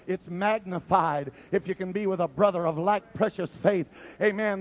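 ordinary delivery

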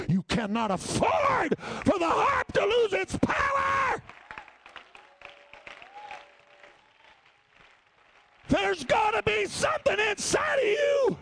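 high, loud, slow hollered preaching; full-force preaching. Acted. Preacher pleads with the congregation, full-force shouting. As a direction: preach it out at the top of your voice.

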